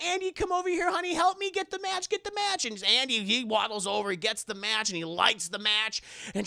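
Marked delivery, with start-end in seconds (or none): 0.0-2.6 high-pitched urgent mother; put-on high voice, pleading for help; Karen. Mother calls Andy to get the match, high and urgent.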